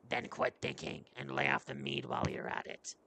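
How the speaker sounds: very deep, rough warrior voice; gruff companion. Acted. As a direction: dismiss them in a low growl.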